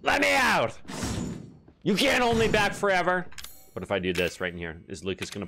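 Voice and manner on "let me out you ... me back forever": mock desperate cry. Trapped voice cries to get out.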